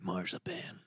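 whispered promo tagline; hushed echo. Pretend. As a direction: drop to a tiny mysterious whisper. Hushed echo ending mock promo.

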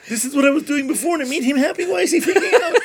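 put-on very high, loud, laughing child voice; mock little girl. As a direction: play a distraught kid, squeaky and giggling. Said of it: "she's like".

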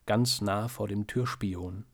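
ordinary delivery, nothing marked

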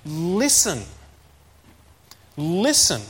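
"Listen" as a firm standalone order; emphatic command. Retold or imitated. Preacher quoting Jesus' imperative to listen, commanding. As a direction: command "Listen", each one set apart by a pause. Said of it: "It's like a command".

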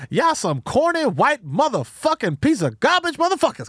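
high, drawn-out comic insult; Eddie Griffin. Acted. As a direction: stretch the curses, hammed-up and high. Continues Eddie Griffin cursing impression.

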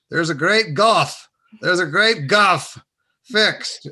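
mimicking the old Southern man's solemn words; Mister Rabb's voice. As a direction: intone it like the old man preaching. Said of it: "he's speaking Mister Rabb language".

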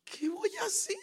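high, cracking near-sob question; choked-up plea; tearful cry. Retold or imitated. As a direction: let the voice break as if about to cry. Despair at not knowing how to speak.

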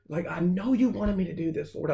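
mild desperate plea; retold pleading prayer. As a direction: plead upward, reliving the confusion.

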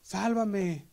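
high begging plea; pleading. Retold or imitated. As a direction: beg to be saved. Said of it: "Le dice".

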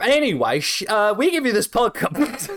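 high, breaking up with laughter; trying to talk through the giggles; after laughing hard. Real, not acted. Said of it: "let me compose myself".

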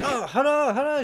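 high, mock-excited restaurant lady; accented, hammed-up excited greeting; imitated excited greeting. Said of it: "She gets all excited".

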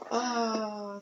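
ordinary talk

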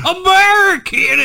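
loud, high, drawn-out patriotic yell; patriotic holler. Pretend. Co-host celebrating Fourth of July, over-the-top holler. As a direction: holler it proudly, stretching it out.

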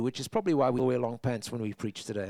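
usual speaking voice

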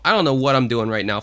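normal talking voice

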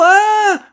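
long, very high scream; child's piercing shriek of terror; terrified scream. A narrator's reading. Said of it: "Quentin hurla de terreur". (long cri de terreur suraigu)

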